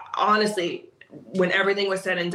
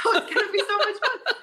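much higher, laughing through the words; laughing glee; faster than usual. Retold or imitated. Teasing her reluctant student, much higher laughing glee. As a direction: tease her with giggly excitement.

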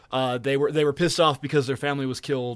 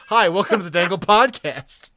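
louder, upbeat host greeting; a punchier retake of the intro; bright podcast intro; 4 semitones higher, 6 dB louder than usual. Pretend. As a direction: sell the welcome like a show host. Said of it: "I don't know why I said I'm a wizard".

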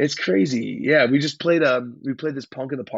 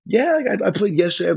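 pitched-up eager answer; nervous excited reply; 8 semitones higher, 4 dB louder than usual. Retold or imitated. Young musician answering a rock star, high excited reply. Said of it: "And I was like".

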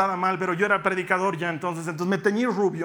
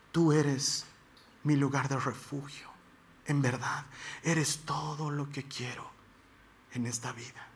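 hushed, lower psalm prayer fading away; soft prayerful reading; 4 semitones lower, 7 dB quieter, slower than usual. Acted. Preacher reads Psalm 142 to the congregation, hushed and prayerful. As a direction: read the psalm softly, as a whispered prayer.

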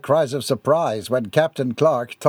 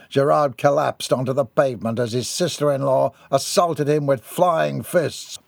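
plain reading voice

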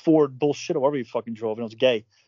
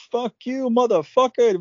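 higher, imitated outburst; mock snapping; 9 semitones higher, slower than usual. Retelling how the bookkeeper snapped at him, mock angry. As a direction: spit it out like she snapped. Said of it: "she just snapped".

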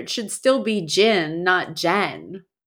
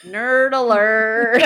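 slow, drawn-out mock alarm; playful siren call; teasing nerd alert; 5 dB louder, slower than usual. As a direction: tease them as a nerd. Teasing the writer.

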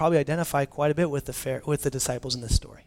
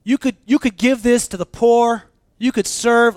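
loud, pushy protest, higher pitched; complaining disciples; 8 semitones higher, 10 dB louder, slower than usual. Retold or imitated. Disciples protesting to Jesus, loud and pushy. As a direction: badger him to spend it on the poor. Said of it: "And the disciples are going".

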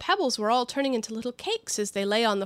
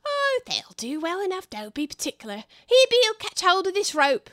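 high, bustling workman calling up to Bill; a chirpy little labourer giving orders; busy workers; 9 semitones higher than usual. Acted. Workman calling up to Bill on the roof, high and bustling.

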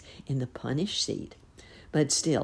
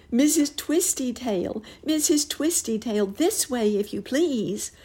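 high calling voice, much higher; 10 semitones higher, 4 dB louder than usual. A narrator's reading. Nurse Jane calling up to the pig lady. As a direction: call up to her in the air. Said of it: "called Nurse Jane".

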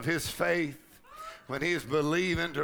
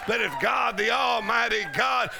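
raised, driving preacher's voice; high-pitched revival fervour; full-force preaching; 6 semitones higher, 4 dB louder than usual. Real, not acted. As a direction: build it fervently for the congregation.

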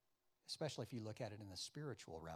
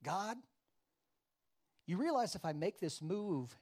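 raised, louder mock objection to God; reasoning with God; 6 semitones higher, 6 dB louder than usual. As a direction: argue upward, as if correcting God. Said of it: "we might say things like this".

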